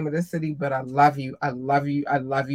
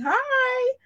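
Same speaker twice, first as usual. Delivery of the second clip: squeaky cute voice. Acted. Cutesy greeting.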